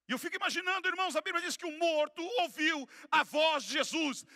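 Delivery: loud, high-pitched preacher's cry; full-force preaching. Real, not acted. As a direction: preach it at full volume, hammering each phrase.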